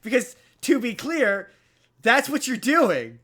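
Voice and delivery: loud, high accusing rant; indignant. Real, not acted. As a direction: call out the cheating, outraged. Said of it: "That feels so arbitrary".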